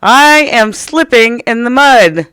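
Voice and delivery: loud, high, drawn-out cry; urgent mock panic, high and loud; alarmed exclamation. Shown as a demonstration. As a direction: call it out as if sliding right now. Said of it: "probably sound more like this".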